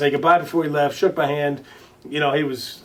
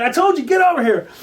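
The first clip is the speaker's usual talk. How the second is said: much higher, loud hammed-up holler; imitated yell; 12 semitones higher, 5 dB louder than usual. Ballplayer ordering the young singer over, imitated loud yell. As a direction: bark the order across a crowded room. Roger summons Justin again.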